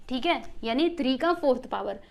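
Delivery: ordinary talk, nothing marked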